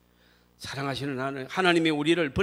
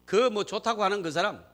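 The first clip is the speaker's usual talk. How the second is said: normal talking voice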